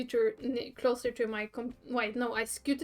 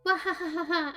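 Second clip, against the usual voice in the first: maniacal cackle; 3 semitones higher than usual. Acted. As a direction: cackle like a proud mad genius. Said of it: "She cackled maniacally".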